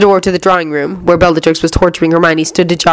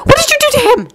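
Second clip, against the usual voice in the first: very high, distraught mother's demand; calling out; faster than usual. A narrator's reading. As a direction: cry the question at his captors. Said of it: "she called out".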